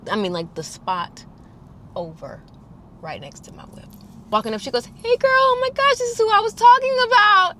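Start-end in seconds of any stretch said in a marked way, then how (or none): 4.9-7.5 high, loud, fast bubbly greeting; bubbly roommate. Retold or imitated. Roommate greeting Lauren in the parking lot, high bubbly gush. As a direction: gush like an oblivious friend.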